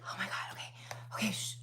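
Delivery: mock drunk whisper; imitating a drunk person whispering to calm everyone. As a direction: hush it without voice. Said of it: "they'll be like".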